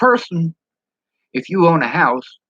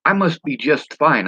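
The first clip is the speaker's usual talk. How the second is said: ordinary talk, nothing marked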